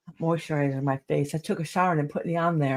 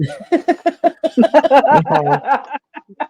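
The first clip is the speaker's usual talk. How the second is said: a real laugh, higher and louder; 10 semitones higher, 7 dB louder than usual. Real, not acted. Laughing after telling about her husband's worry. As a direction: break into a long laugh.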